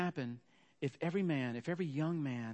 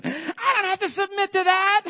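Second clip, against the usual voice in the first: much higher and louder, a shouted refusal; defiant yell; 13 dB louder than usual. Retold or imitated. Wife bursting back into the bathroom, defiant shout. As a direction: throw the defiance in as the door bursts open.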